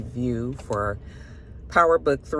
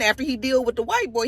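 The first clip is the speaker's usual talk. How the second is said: ordinary talk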